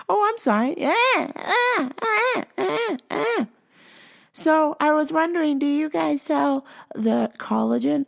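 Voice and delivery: high, dim caller asking; squeaky mock customer. Caller voiced in retelling.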